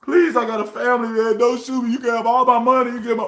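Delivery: high, begging for his life; cowardly pleading. Retold or imitated. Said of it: "play the coward role and be like".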